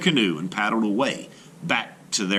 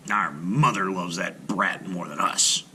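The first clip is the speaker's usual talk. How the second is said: muttered; 3 semitones lower than usual. A narrator's reading. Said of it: "they muttered".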